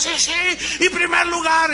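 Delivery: high, excited shout; euphoric cheering. Retold or imitated. Workers celebrating after the rally, euphoric. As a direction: cheer like you won. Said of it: "bien eufóricos".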